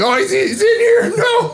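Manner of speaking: very high frightened laughing cry; panicked yelp. Retold or imitated. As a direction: shriek in mock terror. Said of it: "We're like".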